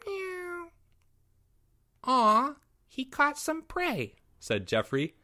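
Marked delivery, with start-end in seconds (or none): 0.1-0.7 very high, faint kitten mew; tiny cat cry; soft meow. Acted. Fluffles looking up at Jeffrey, soft meow. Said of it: "gave another". 2.0-4.1 put-on very high, soft, slow child voice; doting little boy. Jeffrey admiring his kitten's catch, soft high cooing.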